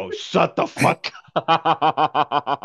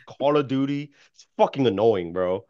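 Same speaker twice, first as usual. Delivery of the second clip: usual speaking voice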